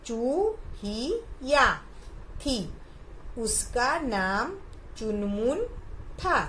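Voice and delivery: put-on slow model reading for children; each word given room. Shown as a demonstration. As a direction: read the sentence slowly, word by word. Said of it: "देखो, जैसे".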